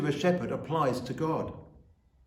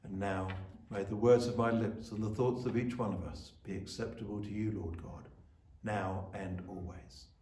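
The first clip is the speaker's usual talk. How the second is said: soft, lowered prayer voice; quiet prayer; 5 semitones lower, 5 dB quieter than usual. Acted. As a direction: pray it gently, as if heads are bowed. Closing prayer after reading.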